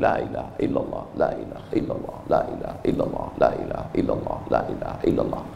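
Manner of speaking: soft, voiceless whisper of la ilaha illallah; whispered dhikr. Shown as a demonstration. Preacher shows the troubled how to whisper dhikr. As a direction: breathe the words out like counting prayer beads. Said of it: "ambil tasbih".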